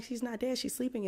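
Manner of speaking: high, offhand and easy. Retold or imitated. Jesus at the girl's bedside, high and casual. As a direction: say it like it's nothing, lifted high. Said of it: "He's so casual about it".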